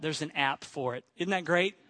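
normal talking voice

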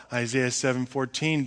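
ordinary delivery

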